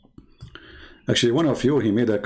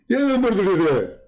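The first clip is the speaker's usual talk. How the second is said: high mock refusal; 9 semitones higher than usual.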